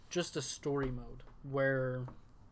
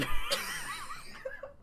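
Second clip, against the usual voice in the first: laughing fit; 4 dB louder than usual. Real, not acted. Breaks up mid-line.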